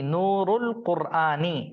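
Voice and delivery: Nurul Kurani read slowly, syllable by syllable; slow model reading. Shown as a demonstration. Teacher models the phrase for reading students, slowly. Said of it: "तो इसको पढ़िए जरा".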